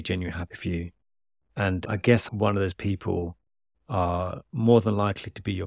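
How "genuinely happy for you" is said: softer, sincere restatement of genuinely happy for you; soft emphatic repeat. Shown as a demonstration. Praising a mother's pride, repeated softly and sincerely. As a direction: drop the voice and mean genuinely. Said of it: "like, genuinely".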